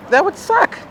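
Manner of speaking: high, whiny delivery; high mock whine. Real, not acted. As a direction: squeak it out comically. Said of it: "self comment".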